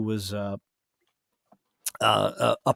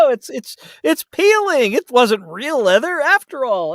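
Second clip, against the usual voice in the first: dismayed; 11 semitones higher, 7 dB louder than usual. Retold or imitated. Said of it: "You're like".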